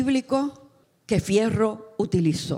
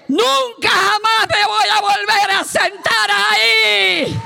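slow, high, full-voiced roar; triumphant shout; 12 semitones higher, 7 dB louder, slower than usual. Fierro tells wife he will never sit again, triumphant shout. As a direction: bellow it defiantly, drawing out the last word. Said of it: "Él le dice".

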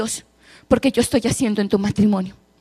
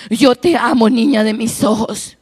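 ordinary delivery

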